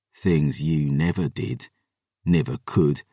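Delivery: twist the knife quietly; soft, deliberate cruelty in character; low cruel taunt. A narrator's reading. Taunting a former lover.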